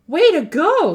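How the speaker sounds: high, loud praise; excited congratulation; cheering. Acted. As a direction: cheer him on. Cheering friend's success.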